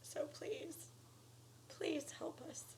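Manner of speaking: hushed, begging please; soft pleading whisper. Real, not acted. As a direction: whisper the plea softly. Final begging plea.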